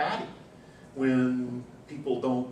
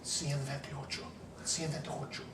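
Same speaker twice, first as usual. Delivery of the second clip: quiet aside; 2 semitones higher, 9 dB quieter than usual. Retold or imitated. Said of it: "told her in Spanish".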